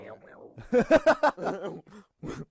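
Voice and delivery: loud, high-pitched burst of laughter; real squealing laughter; high cackling laugh. Real, not acted. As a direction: crack up helplessly, high and loud. Cracking up at a strange noise.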